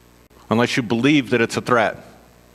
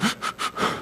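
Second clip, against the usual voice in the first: gasp; 9 dB quieter than usual. Retold or imitated. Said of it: "And she's like".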